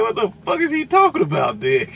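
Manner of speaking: high, loud disbelieving shout; incredulous. Retold or imitated. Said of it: "I said".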